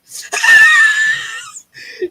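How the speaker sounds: squealing laugh, very high; high shrieking laugh. Real, not acted. Laughing at own bag wish.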